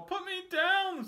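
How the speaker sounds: very high, loud, slow wail; panicked fish. Acted. Dangled fish pleads slowly with the cat, very high. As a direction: drag out the plea. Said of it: "said the fish".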